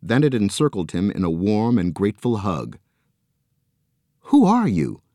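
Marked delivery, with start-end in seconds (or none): 4.3-5.0 high, eager asking; wide-eyed wonder in the voice; amazed question. A narrator's reading. Joe asking the arm, high and amazed. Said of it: "asked Joe".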